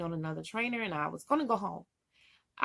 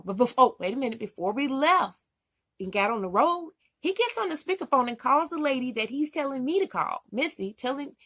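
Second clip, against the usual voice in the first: ordinary talk, nothing marked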